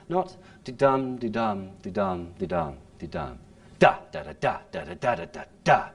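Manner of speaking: rhythmic da-da chant of the meter; iambic rhythm. Shown as a demonstration. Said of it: "makes the iambic pentameter canter".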